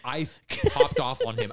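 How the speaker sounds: ordinary talk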